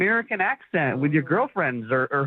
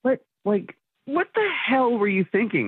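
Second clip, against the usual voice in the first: higher-pitched, indignant disbelief; 6 semitones higher than usual. Real, not acted. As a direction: confront her, exasperated. Confronts nanny after her confession.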